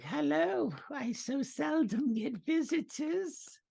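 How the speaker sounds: very high, slow sweet crone; old witch voice. Acted. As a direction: welcome guests with sugary menace. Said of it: "And she's like".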